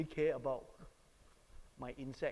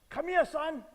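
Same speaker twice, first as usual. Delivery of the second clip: high, loud summons to a son; reenacted father's angry call; angry command; 13 semitones higher, 11 dB louder than usual. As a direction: order your son over, acting out anger. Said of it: "Angry, right?".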